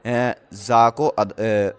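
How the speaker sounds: ordinary talk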